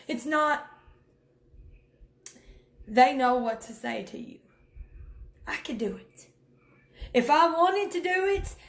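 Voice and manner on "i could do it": unvoiced whisper, soft and close; quiet whispered admission. Real, not acted. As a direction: breathe it like a secret. Whispered aside, then repeated aloud.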